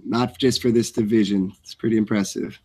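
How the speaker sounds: normal talking voice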